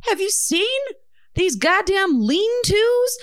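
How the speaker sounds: high-pitched, exasperated mock outburst; apoplectic expert. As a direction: imitate an epidemiologist losing it on air. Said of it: "like, having a stroke on air".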